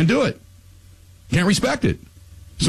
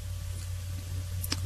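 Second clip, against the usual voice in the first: tired sigh; 17 dB quieter than usual. Real, not acted. After the rant.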